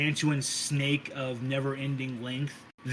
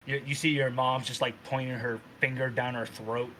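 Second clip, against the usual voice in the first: ordinary talk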